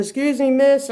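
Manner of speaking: loud, high attention-getter; calling out. Retold or imitated. Delivery driver calls to girl in car, calling out. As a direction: imitate a man hailing someone.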